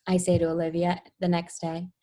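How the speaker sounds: plain reading voice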